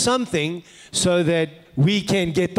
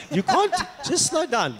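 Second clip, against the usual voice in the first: laughing; 10 semitones higher than usual. Retold or imitated. Laughing while telling him.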